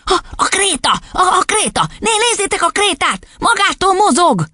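very high, stammering alarm; piercing cry. A narrator's reading. Boy pointing at the moving chalk, piercingly excited. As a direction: squeal in astonishment. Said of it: "kiáltotta olyan éles hangon".

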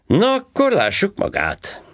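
high, loud mocking cop voice; sarcastic policeman. A narrator's reading. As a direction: needle him like a smug traffic cop. Policeman to passenger. (gúnyos rendőrhang, magasan és hangosan)

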